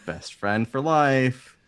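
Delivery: high, loud, drawn-out devotion; slow, high-pitched pledge to a best friend; Valka. Acted. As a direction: gush it high and stretch it out. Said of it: "Valka goes".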